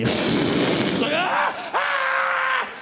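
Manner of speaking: high rising scream; mock scream. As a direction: shriek as the plane drops. Sudden combat landing.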